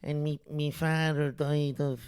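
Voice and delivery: sorrowful Dublin beggar's tale; dublin accent. Acted. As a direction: spin a sad lie in a Dublin accent. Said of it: "begging in a Dublin accent".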